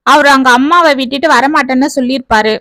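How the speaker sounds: ordinary delivery, nothing marked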